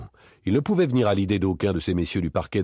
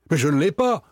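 high, louder denial; raised-voice self-defence; heated protest; 10 semitones higher, 6 dB louder than usual. A narrator's reading. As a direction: protest loudly, pitched up. Accused man protests.

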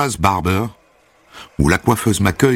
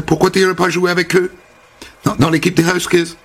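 high, bubbly American welcome; chirpy American hairdresser; 7 semitones higher than usual. Acted. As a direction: greet him like an eager stylist. Holly s'enthousiasme pour le basket. (coiffeuse américaine enjouée, voix haute)

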